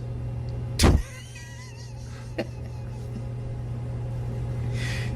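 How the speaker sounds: sustained high-pitched laughter; long high laugh. Real, not acted. Laugh alone on line.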